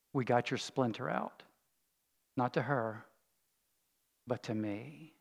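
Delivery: soft, quiet, tender telling; gentle voice of God. Retold or imitated. Preacher voicing God's words to him, soft and tender. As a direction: hush it like a gentle revelation. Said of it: "God saying to me is".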